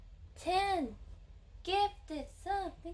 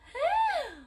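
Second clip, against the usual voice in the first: gasp; 14 semitones higher, 5 dB louder than usual. Real, not acted. Stunned by huge gift.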